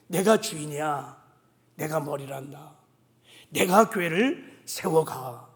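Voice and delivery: God speaking low and soft inside the pastor's heart; inner voice of God. Retold or imitated. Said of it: "내면의 음성이 막 들려오기 시작했어요". (낮고 조용한 내면의 하나님 음성)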